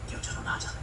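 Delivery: hushed, barely voiced whisper; conspiratorial whisper; secret whisper. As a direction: whisper so nobody hears. Brothers sneaking, whispering to each other.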